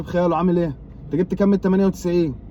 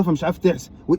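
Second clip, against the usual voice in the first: ordinary talk, nothing marked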